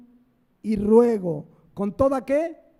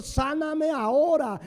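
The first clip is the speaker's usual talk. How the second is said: higher, slow, bossy demand; demanding prayer; 5 semitones higher than usual. Retold or imitated. Believer demanding healing from God, mock demanding. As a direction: order God to heal you now. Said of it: "no vienes y le dices al Señor".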